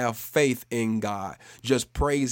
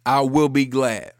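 loud, slow, emphatic declaration; said like meaning it; 7 dB louder, slower than usual. Shown as a demonstration. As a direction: declare each phrase with full conviction for repeating. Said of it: "say it as you mean it".

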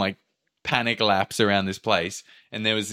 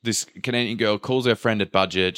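ordinary talk

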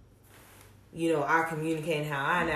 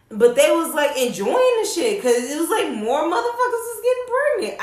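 laughing, pitch climbing higher and louder; laughing disbelief; 11 semitones higher, 7 dB louder than usual. Real, not acted. Telling a friend about pregnant classmates, laughing in disbelief.

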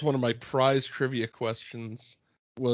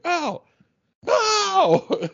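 mock ow; 13 semitones higher, 3 dB louder than usual. Reacting to Elaine's seat slam.

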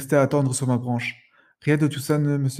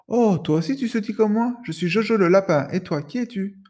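cheerful rabbit voice; 7 semitones higher than usual. Acted. Rabbit greets the parrot.

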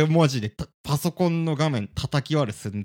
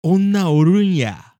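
slow, drawn-out mock Kansai line, louder and higher; imitating Kansai dialect; 6 semitones higher, 8 dB louder, much slower than usual. Mocking the co-host's Kansai slip on video, slow imitation. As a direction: parody the co-host's Kansai phrase, slowly. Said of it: "ってこれ関西弁じゃん".

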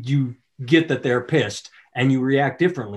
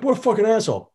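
offended scoff; 9 semitones higher, faster than usual. Retold or imitated. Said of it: "then you're like".

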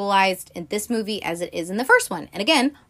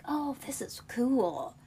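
soft, drawn-out, higher murmur of wonder; quiet wonder; 5 semitones higher, 10 dB quieter than usual. Retold or imitated. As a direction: marvel under your breath, slowly. Retelling girl's curious discovery.

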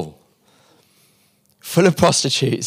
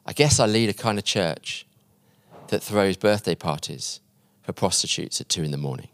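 low, soft, measured reply; understated, deliberate confession; quiet, humble; 4 semitones lower, 6 dB quieter than usual. Retold or imitated. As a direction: let it sink gently to a hush. Tony's humble answer, story's climax.

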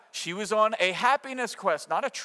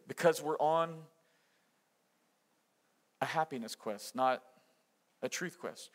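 dropped to a hush; 4 semitones lower, 5 dB quieter than usual. Acted.

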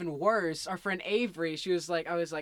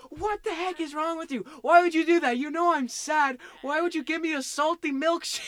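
high, loud hammed-up outrage; mock angry yell; 9 semitones higher, 6 dB louder than usual. After drinking the salty drink, hammed-up angry yell at Avery. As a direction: yell it like a wronged kid. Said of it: "and I was like".

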